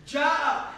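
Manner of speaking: "Ja'a" punched loud and drawn out; strong-toned. Shown as a demonstration. Teacher showing a powerful verb, "Ja'a" said forcefully. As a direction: hit the long vowel of "Ja'a" hard. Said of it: "strong-toned verb".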